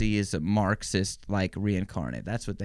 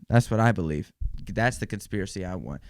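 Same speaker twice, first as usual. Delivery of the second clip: ordinary talk, nothing marked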